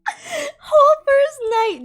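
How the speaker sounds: high, loud laugh-crying. Real, not acted. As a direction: laugh through the words. Laughing while telling awful story.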